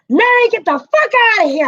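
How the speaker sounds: sudden high, loud mock yell; startling yell. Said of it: "scared the ever-living hell out of me".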